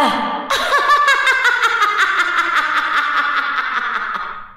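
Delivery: long evil cackle, falling in pitch; loud mad laugh. Acted. Crazed woman laughs in Kara's face after the threat, mad laugh. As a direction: cackle and let it echo away. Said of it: "malakas na tawa nito".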